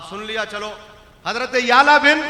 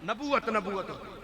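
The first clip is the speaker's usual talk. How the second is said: soft echo; 12 dB quieter than usual. Acted. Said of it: "soft repeat after shout".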